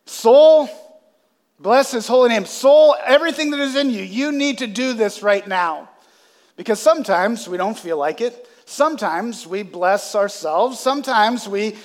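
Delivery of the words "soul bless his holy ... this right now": high, loud urgent command to himself; David urging his soul. Retold or imitated. David commands his own soul to bless God, urgent and loud. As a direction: rouse your own soul, higher and louder. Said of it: "And so he says".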